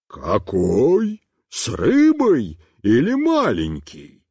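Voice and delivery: slow demanding adult question; a fat man in striped trousers yelling at the boy. A narrator's reading. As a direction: bellow at the crowd. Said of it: "завопил".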